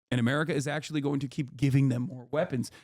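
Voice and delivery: ordinary delivery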